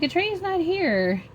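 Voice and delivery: high-pitched, puzzled objection; recounted bafflement, pitch jumping high; retold disbelief. Retold disbelief at mother's vision.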